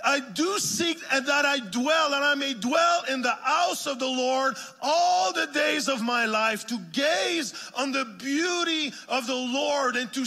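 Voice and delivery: high, loud, driving sermon voice; full-force revival preaching; fervent preaching. Acted. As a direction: preach it out to the whole church, building fire.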